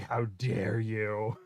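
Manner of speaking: drawn-out, scandalized how dare you; a slow, teasing how dare you; slow mock outrage. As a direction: play it offended, slowly. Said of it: "Laser's like".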